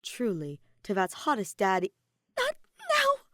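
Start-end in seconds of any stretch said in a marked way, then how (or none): 2.4-3.2 high, loud squeal of self-reproach; panicked, high-pitched scolding; admonishing herself. A narrator's reading. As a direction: shriek it at your own thoughts. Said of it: "she admonished her vulgar thoughts".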